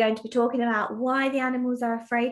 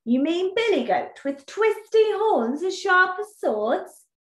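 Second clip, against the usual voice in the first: high, fearful bull asking; a scared bull quakes at the name; frightened bull; 6 semitones higher than usual. Acted. Bull shivering, asking Badger about the goat, high and fearful. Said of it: "The bull shivered in fear".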